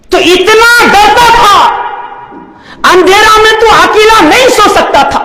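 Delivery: high, crying preacher's lament; anguished exhortation. Real, not acted. Preacher reminds man he feared the dark, anguished exhortation. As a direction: cry out high to the listener.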